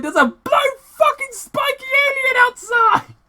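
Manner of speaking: very high, loud shriek; panicked scream. Retold or imitated. Husband shrieking to his wife about a spiky alien. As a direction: shriek in terror at the window.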